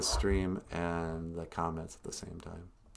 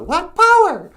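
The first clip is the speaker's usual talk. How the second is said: very high, loud puppet cooing; 16 dB louder, slower than usual. Pretend. Puppet Natasha playing the Labyrinth bit, very high. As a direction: coo it as the puppet.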